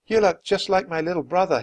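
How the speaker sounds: weak, hoarse voice. A narrator's reading. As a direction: rasp it out gently. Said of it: "he said hoarsely".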